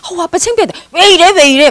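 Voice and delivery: hammed-up, high-pitched embarrassed scolding; embarrassed daughters. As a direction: imitate daughters pleading with their embarrassing father. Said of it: "막 이러더래요".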